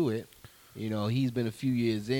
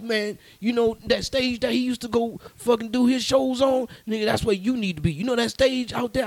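put-on pitched up, pushy old man urging him on; hammed-up older man, higher and louder; older gentleman; 11 semitones higher, 6 dB louder than usual. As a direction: lean in like an insistent older guy hyping a young comic. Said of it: "He's like".

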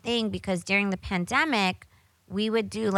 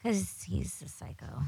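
whispered aside; 13 dB quieter than usual. Real, not acted. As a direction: drop to a breathless whisper for the insult. Gossiping about an ex-coworker.

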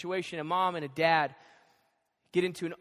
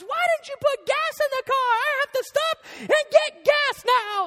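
very high, loud screechy tirade; hammed-up falsetto meltdown; mock hysterical yelling; 6 dB louder than usual. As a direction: imitate a wife scream-crying down the phone. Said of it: "hysterically crying, yelling at me".

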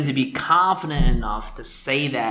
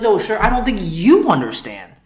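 higher, louder mock firmness; firm attendant; 6 semitones higher, 6 dB louder than usual. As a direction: put your foot down with him.

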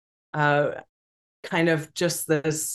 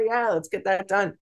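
high, laughing re-enactment of herself; mock-giddy, higher-pitched laughing agreement; laughing hysterically; 7 semitones higher than usual. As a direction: blurt a high "oh", then laugh through the reply. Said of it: "laughing hysterically".

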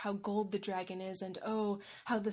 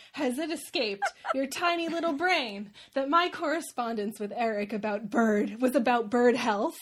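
mock-furious rant, loud and high; 3 semitones higher, 6 dB louder than usual. [name] rants at Kimberly about bird police, mock-furious. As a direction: tear into Kimothy, mock fury building. Furious reply email read aloud.

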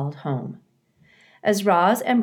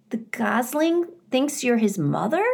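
put-on higher, curious young beaver voice, not much quieter; 7 semitones higher than usual. A narrator's reading. Paddler asks the robot about the gosling, higher. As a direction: ask it like a wondering child. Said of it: "came a quiet voice".

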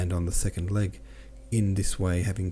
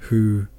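"who" hit firmly and louder; strong emphasis; 6 dB louder than usual. A narrator's reading. A voice across the shed naming someone, strong emphasis on "who". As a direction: lean hard on the word "who". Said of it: "with a strong emphasis on the".

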